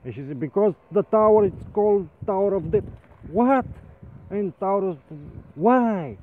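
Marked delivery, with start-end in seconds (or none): 0.4-2.8 high, loud furious wife; a shouting wife, hammed up; yelling wife. 3.3-3.7 very high loud what; hammed-up outraged cry; shocked what. He cries out in disbelief. 4.3-6.2 very high loud what; hammed-up outraged cry; shocked what. He protests in disbelief.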